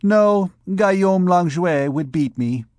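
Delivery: a hint of a frightened girl; timid young girl.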